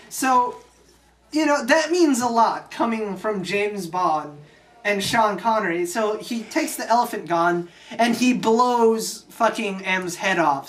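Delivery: ordinary talk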